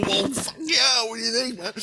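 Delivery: laughing protest. Retold or imitated. Laughing protest about balls.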